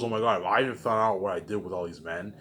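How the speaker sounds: ordinary delivery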